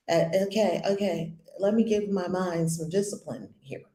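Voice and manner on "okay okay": ordinary talk, nothing marked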